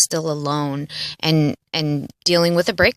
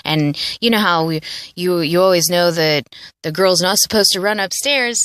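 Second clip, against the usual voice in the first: normal talking voice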